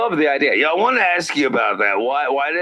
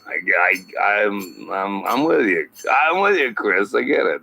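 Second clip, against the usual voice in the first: ordinary talk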